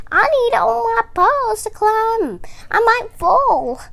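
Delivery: a small timid squirrel, afraid; nervous squirrel voice. A narrator's reading. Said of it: "Squirrel said".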